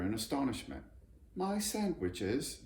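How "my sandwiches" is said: high, slow indignant cry; indignant little bear; exclaimed hotly. A narrator's reading. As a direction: protest in outrage. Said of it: "he exclaimed hotly".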